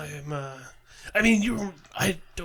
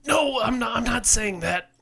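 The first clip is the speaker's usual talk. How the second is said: shouted denial, pitched up; loud protest; 5 semitones higher, 7 dB louder, slower than usual. Real, not acted. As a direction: protest loudly and fast. Protesting loudly.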